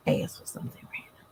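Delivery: soft unvoiced aside; whisper. Real, not acted. Vlogger griping about office visits, whispered.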